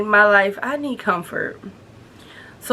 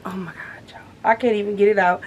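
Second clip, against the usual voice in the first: excited whisper; faster than usual. Real, not acted.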